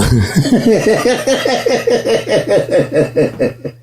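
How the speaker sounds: long, very high giggle; helpless high-pitched giggling fit; giggling hard. Real, not acted. Giggles at a small thing.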